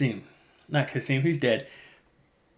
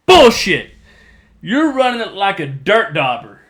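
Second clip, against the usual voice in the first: louder, higher contemptuous shout; scornful outburst; 5 semitones higher, 8 dB louder than usual. Retold or imitated. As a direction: blast the plan with contempt. Bane scorns Khan's plan.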